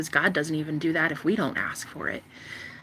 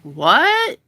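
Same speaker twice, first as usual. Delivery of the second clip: loud, high shocked "What?"; 7 semitones higher, 9 dB louder than usual. Retold or imitated. Boyfriend shocked at her worshiping herself, loud disbelief. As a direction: blurt it out in disbelief.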